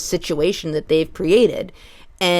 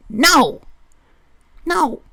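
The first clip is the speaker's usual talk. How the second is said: loud, higher, insistent denial; emphatic no; 10 semitones higher, 6 dB louder than usual. Retold or imitated. Employee cutting off the storyteller with a loud higher no. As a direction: blurt the no, then push it even higher.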